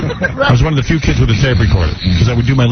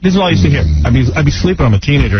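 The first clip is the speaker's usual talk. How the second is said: ordinary talk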